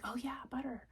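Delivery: breathy whispered realization; soft unvoiced aha. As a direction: whisper it as if remembering. Retold remembering in the store.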